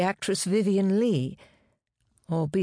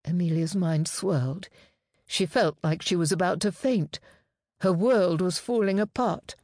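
usual speaking voice

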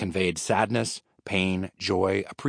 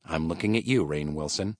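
usual speaking voice

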